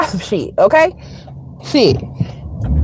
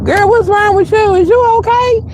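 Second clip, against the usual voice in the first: put-on much higher, disbelieving "girl" squeal; incredulous; 12 semitones higher than usual. Answering the fawning coworker, high incredulous. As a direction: shoot up high in mock disbelief. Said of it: "I'm like".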